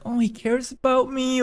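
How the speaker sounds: high, soft imitation of a crush; softly put-on high swoon; mock romantic swooning. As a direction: lilt it up softly, pretending to be smitten. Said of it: "it might be romantic".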